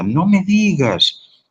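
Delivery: high, slow, delighted gasp of surprise; pleased astonishment at the friend's news; surprised. Shown as a demonstration. Friend hears Margarita bought a car, surprised delight. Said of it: "denota sorpresa".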